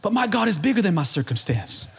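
ordinary delivery

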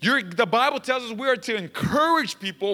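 high, energetic exhortation; rousing preaching. Real, not acted. Pastor urging church toward a new way of talking, rousing preaching. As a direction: urge the church on with rising force.

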